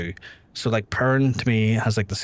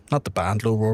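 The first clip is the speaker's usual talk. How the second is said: ordinary delivery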